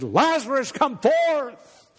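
loud high command into the tomb; calling out. Acted. Jesus commanding Lazarus to rise, a ringing call.

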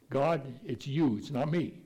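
ordinary talk

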